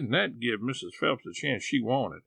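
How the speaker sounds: usual speaking voice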